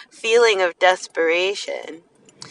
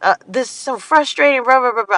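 mock fury at being sold to; frustrated rant, pitched up; imitating angry self; 7 semitones higher than usual. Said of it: "I would just get so angry".

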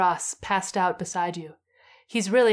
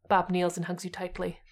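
usual speaking voice

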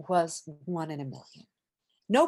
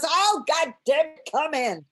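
loud, high mock-irritated yell; comic imitation of an exasperated man shouting; 10 semitones higher, 7 dB louder than usual. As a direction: give in crossly and holler through the door. George gives in, exasperated.

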